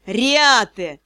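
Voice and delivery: hissed name, drawn out and menacing; angry hiss. A narrator's reading. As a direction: hiss it at a pupil in trouble. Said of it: "прошипел".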